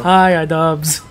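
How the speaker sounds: slow, loud hello to the camera; loud drawn-out greeting. Real, not acted. Greeting the YouTuber through the camera, loud and drawn out. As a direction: call out a big slow hi.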